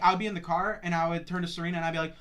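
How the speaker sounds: usual speaking voice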